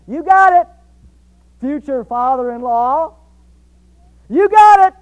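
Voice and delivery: loud, high, acted enthusiasm; eager agreement. Jacob accepts seven years' work for Rachel, loudly eager. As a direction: jump at the deal with gusto.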